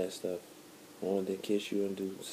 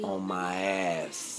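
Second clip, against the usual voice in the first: slow and drawn out; much slower than usual. Shown as a demonstration. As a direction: stretch each word out slowly. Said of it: "Just like that".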